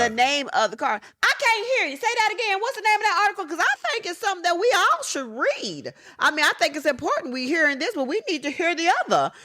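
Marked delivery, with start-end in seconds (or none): 1.2-9.3 much higher, calling out to be heard; pushy man shouting a question across a ballroom; mock holler with a push of urgency; retold yell across the room. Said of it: "he's yelling across the room".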